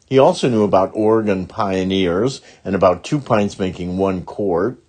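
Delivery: ordinary delivery, nothing marked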